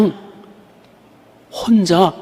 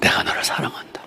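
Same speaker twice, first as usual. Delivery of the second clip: hushed voice of the Lord. Retold or imitated. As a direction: soothe her as a gentle father. Voicing the Lord's answer.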